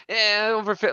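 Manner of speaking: mock noncommittal "eh" from a doctor; imitated doctor. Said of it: "and the doctor's like".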